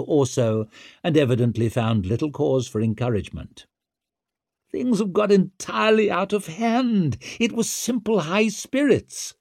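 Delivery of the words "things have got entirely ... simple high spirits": high-pitched protesting prisoner; shrill self-justification; Wetterland, desperate excuse. Acted. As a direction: explain it away in a raised, pleading voice.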